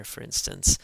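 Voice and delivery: normal talking voice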